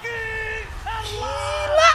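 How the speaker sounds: excited shout. Real, not acted. Reacting to a crazy play.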